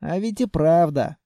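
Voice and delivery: plain reading voice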